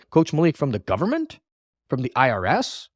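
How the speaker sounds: "government" and "I R S" each rising; questioning intonation. Shown as a demonstration. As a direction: swing "government" up as if they should already know. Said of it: "like a question".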